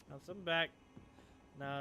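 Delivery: ordinary talk, nothing marked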